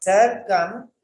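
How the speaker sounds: Circum said on its own with a rising end; Circum held up as the first chunk of the word; Circum marked off and left hanging; split syllables. Shown as a demonstration. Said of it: "you can say circumstances like this".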